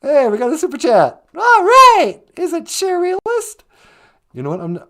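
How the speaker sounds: ordinary delivery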